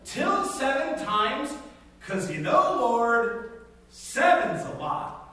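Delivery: high, loud pleading complaint; whiny Peter. Acted. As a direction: beg the Lord like a put-upon disciple. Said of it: "and said".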